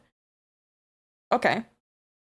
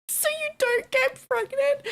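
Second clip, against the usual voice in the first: laughing. Real, not acted. Laughing disbelief.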